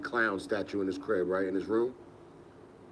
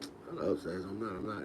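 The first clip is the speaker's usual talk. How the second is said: hushed reply; 8 dB quieter than usual. Retold or imitated. Sneaking in late, parents asleep.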